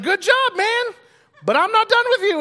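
a hammed-up God clapping him on the back; booming God voice. God answers Gideon in retold story.

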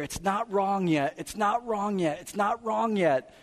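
high, loud reassuring lure; a pushy tempter; coaxing tempter. Retold or imitated. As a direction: insist it's still fine. Culture voiced as a tempter.

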